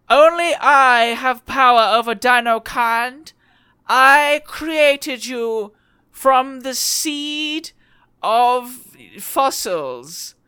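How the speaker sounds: high, slow, sneering villain voice; sneering wrestling villain. Acted. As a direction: gloat like a cartoon tyrant. Villain emerges from mech, gloats.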